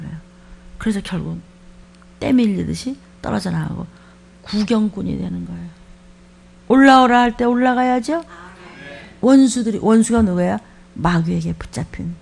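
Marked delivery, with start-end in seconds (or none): none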